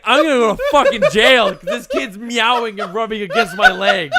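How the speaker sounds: loud, laughing, high-pitched panic. Retold or imitated. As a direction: blurt it out through laughter, half panicked.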